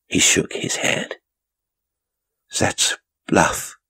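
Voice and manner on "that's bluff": shaky. A narrator's reading. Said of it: "he said shakily".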